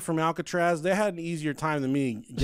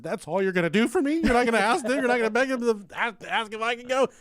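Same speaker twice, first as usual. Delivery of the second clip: indignant plea; 7 semitones higher, 4 dB louder than usual. Retold or imitated. Retold plea to friend, raised pitch.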